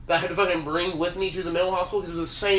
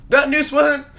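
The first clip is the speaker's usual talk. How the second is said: high, loud, laughing through the rant; yelling his excuse across the desk; retold loud protest; 7 semitones higher, 7 dB louder than usual. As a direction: insist at full volume while cracking up. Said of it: "I'm like".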